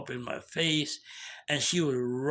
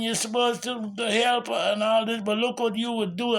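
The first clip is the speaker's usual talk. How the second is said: higher-pitched goading accusation; taunting; 6 semitones higher than usual. Retold or imitated. Young woman provoking the man in his face, taunting. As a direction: needle and scold in a raised pitch.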